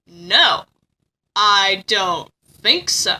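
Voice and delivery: low, slow, halting denial; Soray. Retold or imitated. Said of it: "And Soray's like".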